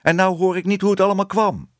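high, quick complaint; fast, disappointed child's protest; regretful. A narrator's reading. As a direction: lament missing out, in a high voice. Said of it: "zei Bibi spijtig".

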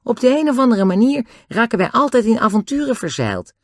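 put-on high-pitched boy's voice; easygoing Dick. A narrator's reading. Dick boasts of adventures to Nobby, high-voiced. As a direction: tell it brightly, pitched well up. Dick's voice in the story.

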